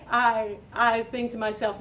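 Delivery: usual speaking voice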